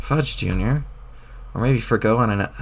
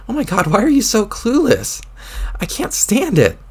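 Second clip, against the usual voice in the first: exasperated outburst; 8 semitones higher than usual. Acted. Jane exasperated at Jake.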